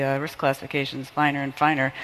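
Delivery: ordinary talk, nothing marked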